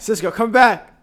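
loud, high call to the dog. Real, not acted. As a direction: call him back across the room. Calling the dog back.

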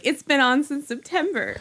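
bright forced customer-service voice; cheery retail clerk. Retold or imitated. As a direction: chirp it with a fake retail smile. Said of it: "with that very happy, like, retail smile was like".

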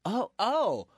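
acted high-pitched bewilderment; startled realization. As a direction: stammer it high, as the news sinks in. Barry reacts to the Batman reveal.

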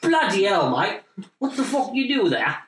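high, loud mock British accent; a hammed-up British accent; bad British accent. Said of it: "a bad British accent".

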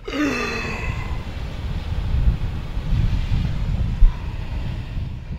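a long, breathy imitated death rattle gasp; imitated last breath. Son acts out his dying dad's last breath, a rasping gasp. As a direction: breathe out the dying man's final rasp.

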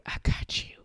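whispered voice of God saying he's got you; God's reassurance. Retold or imitated. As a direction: breathe it like a gentle promise. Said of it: "he has a way of being like".

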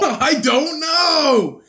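high, slow laughing reply. Real, not acted. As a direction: squeal it while laughing. Laughing after being caught.

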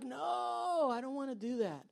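soft mock whine, starting high and falling; frustrated reluctance. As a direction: draw out a reluctant no, then sink. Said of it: "that was his frustration".

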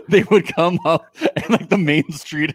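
can't hold the giggles telling it; laughing. Real, not acted. Friend recalling the barrier prank, laughing.

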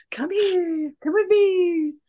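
high, beckoning ghostly voice; eerie calling voice. Retold or imitated. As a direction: lure them into the light. Voicing the ghostly light calling.

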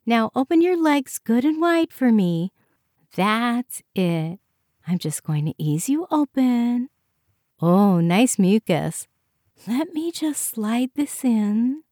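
high, good-natured clinician talking a patient through it; chirpy technician. A narrator's reading.

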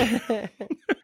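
light amused laugh; a real chuckle after the joke. Real, not acted.

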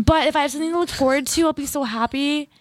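high, bubbly, can't wait for pizza; giddy and excited, voice lifting; giddy anticipation. Real, not acted. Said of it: "I'm giddy right now".

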